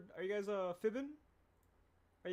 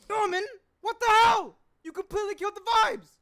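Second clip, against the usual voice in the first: much higher and louder, outraged shriek; shocked cartoon yell; 15 dB louder than usual. Shown as a demonstration. Voice actor demos a character yelling at Norman. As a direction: scream it like a cartoon character losing it. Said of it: "I like that one, but is there a better one".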